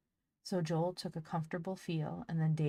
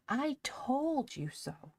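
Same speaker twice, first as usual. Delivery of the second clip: high, slow drawn-out gloating; sing-song gloat; 9 semitones higher, much slower than usual. Acted. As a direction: stretch it out, teasing him. Polly repeats, more sing-song.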